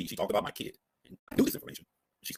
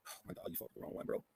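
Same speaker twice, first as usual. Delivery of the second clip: whisper; 11 dB quieter than usual. Real, not acted. Shocked aside after Jackie reveal.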